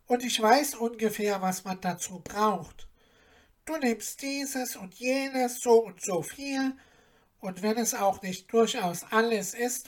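a gently voiced old goose advising; lightly voiced goose, knowing and kind; wise goose Mimi.

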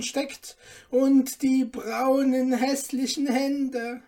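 loud, high calls of gawking onlookers; jeering crowd shout. A narrator's reading. Onlookers crying out at the ugly dwarf, loud jeering shout. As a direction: call it out loudly at a spectacle. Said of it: "Überall hörte er rufen".

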